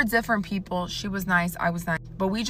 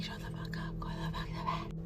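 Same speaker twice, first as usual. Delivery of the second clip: harsh whisper, much softer; hushed whisper; 14 dB quieter than usual. Retold or imitated. Sister whispering to her giggling brother so the roommate won't wake, fierce whisper. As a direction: whisper it so the roommate stays asleep. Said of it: "And so I'm like".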